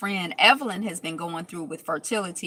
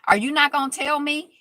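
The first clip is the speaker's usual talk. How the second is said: mock indignant demand; 8 semitones higher, 6 dB louder than usual. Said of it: "Shawnee is like".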